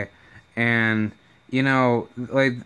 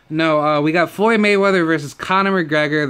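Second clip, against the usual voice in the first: ordinary talk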